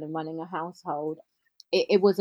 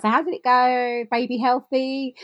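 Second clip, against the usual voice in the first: lightly voiced upbeat husband; 6 semitones higher, 4 dB louder than usual. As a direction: imitate a husband checking in brightly. Husband's cheery question, acted.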